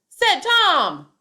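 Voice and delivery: "said Tom" yelled high and loud; wrong version: "said Tom" shouted; yelling the tag. Shown as a demonstration. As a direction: shout the dialogue tag by mistake. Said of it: "which part was yelled".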